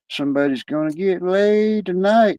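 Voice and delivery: sing-song it like a cheeky parrot; lightly mocking talking bird. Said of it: "this fucking parrot says".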